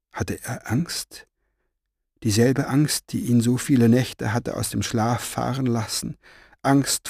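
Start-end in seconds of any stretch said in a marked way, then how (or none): 0.1-1.3 soft unvoiced whisper, slow. A narrator's reading. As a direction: breathe the question out in fear. Anxious question in narration.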